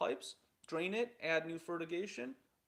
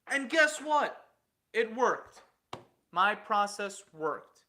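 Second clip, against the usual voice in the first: louder and slow, savoring the win; triumphant; 2 semitones higher, 8 dB louder, slower than usual. Real, not acted. Telling how his basement test proved them wrong, louder and slow. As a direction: land it like a victory lap, slowly.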